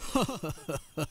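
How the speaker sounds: sly villain's chuckle, soft; evil laugh. Acted. Villain gloats over his plot. (rire maléfique étouffé)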